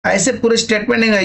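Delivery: ordinary talk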